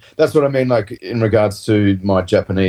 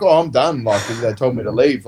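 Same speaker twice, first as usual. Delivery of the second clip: so happy; 3 semitones higher than usual. Retold or imitated. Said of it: "Like, so happy".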